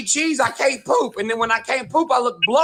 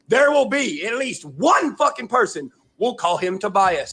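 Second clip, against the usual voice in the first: ordinary delivery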